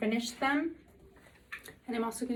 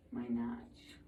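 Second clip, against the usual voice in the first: whispering; 11 semitones lower, 10 dB quieter than usual. Real, not acted. Finds her small fingernail notch.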